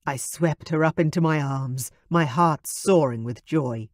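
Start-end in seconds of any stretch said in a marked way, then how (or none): none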